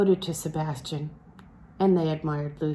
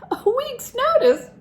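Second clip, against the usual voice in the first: much higher, louder, scornful disbelief; incredulous echo; 5 dB louder than usual. Acted. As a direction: throw the words back in sharp disbelief. Lucy echoes in scornful disbelief.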